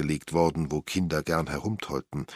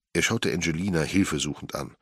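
usual speaking voice

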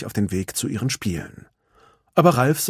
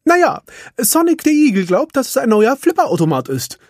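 high, cheerful little handyman; Felix's voice; 6 dB louder than usual. Acted. Felix tells Ralf about the new game, chipper. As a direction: chirp it out, eager and quick.

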